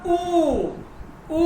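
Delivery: long, stretched "উ"; sustained oo sound on "উ"; long drawn-out vowel. Shown as a demonstration. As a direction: hold "উ" as a long oo. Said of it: "এই ধরনের লম্বা একটা টান দিবেন".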